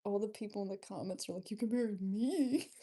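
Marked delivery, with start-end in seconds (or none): 1.5-2.7 loud, swooping hammed-up plea; mock eager offer. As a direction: imitate fans volunteering eagerly, pitch jumping up at the end. Imitating commenters eagerly offering themselves.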